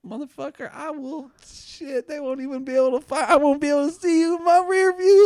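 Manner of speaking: high, rising cocky brag; mock big-city bravado; cocky boast. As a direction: swagger it like a street tough. Boasting, egging on the racer.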